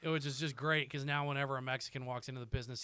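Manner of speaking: ordinary talk, nothing marked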